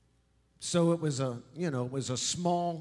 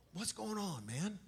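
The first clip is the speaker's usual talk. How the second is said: much softer worried aside; dropped to a near mutter; 5 semitones higher, 11 dB quieter than usual. Acted. Disciples asking Jesus quietly. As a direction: drop the voice suddenly. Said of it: "that was kind of the scene".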